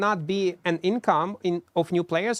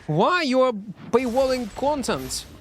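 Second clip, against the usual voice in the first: higher, mock-indignant outburst; retold outrage; 6 semitones higher than usual. Streamer retelling his reaction to the paywall, mock outrage. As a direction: replay the outrage at the news. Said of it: "I was so mad when I saw this news".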